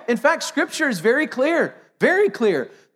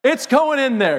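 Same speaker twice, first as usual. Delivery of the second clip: laughing tease; 2 semitones higher than usual. Retold or imitated. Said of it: "And I'm like".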